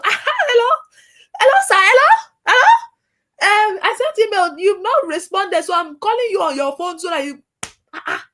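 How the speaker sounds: high, loud, insistent hello-hello caller; mock intrusive caller. Mocking a pushy caller who ignores email, high and loud. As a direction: barge in on the phone, hammed-up and loud.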